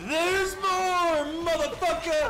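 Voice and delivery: high loud gloating yell; triumphant shout. Real, not acted. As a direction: crow it out in triumph. Triumphant outburst.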